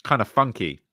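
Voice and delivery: ordinary delivery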